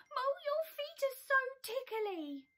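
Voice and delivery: giggling. Acted. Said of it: "Badger giggled".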